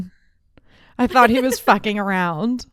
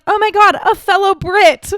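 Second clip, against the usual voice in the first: high, loud excited exclamation; delighted British stranger; 10 semitones higher, 6 dB louder than usual. Retold or imitated. British stranger spots a fellow countrywoman, imitated in high, loud delight. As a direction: imitate someone thrilled to find a compatriot. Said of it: "And then was like".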